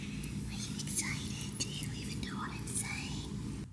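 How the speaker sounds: soft unvoiced whisper to her cat. Real, not acted. Owner whispering to her old cat about the new kitten, unvoiced whisper. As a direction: breathe the words at him, unvoiced and slow.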